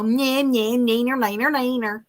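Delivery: sing-song nyeh neener taunt; teasing. Real, not acted. Teasing chant.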